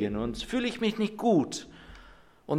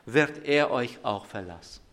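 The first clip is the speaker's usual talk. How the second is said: forceful sermon preaching; 4 semitones lower, 7 dB louder than usual. Acted. Pastor proclaiming the prophet's words to the church, forceful preaching. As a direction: proclaim the verse to the congregation with weight.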